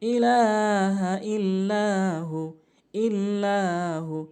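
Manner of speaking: ইল্লাহু with লা held one alif, slow; stretched lam. Shown as a demonstration. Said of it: "লাম অবশ্যই এক আলিফ টানবেন". (লাম এক আলিফ টেনে ইল্লাহু)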